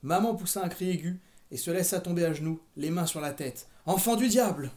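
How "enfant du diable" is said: loud, high lament; anguished wail. A narrator's reading. Mother on her knees cursing Carrie, loud wail. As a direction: wail it as an accusation. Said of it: "gémit-elle".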